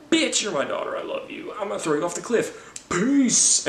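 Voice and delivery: high, breezy villain voice; mock Thanos farewell. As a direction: toss her off with cheery cruelty. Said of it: "Thanos is like".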